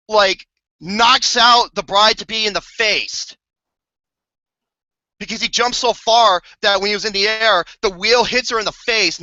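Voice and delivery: ordinary talk, nothing marked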